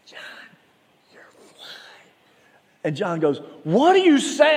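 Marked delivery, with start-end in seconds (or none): 0.1-1.7 urgent whisper. Retold or imitated. Friend discreetly warning about fly. 3.6-4.6 high, loud, baffled yell. Retold or imitated. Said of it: "he just, like, yells out".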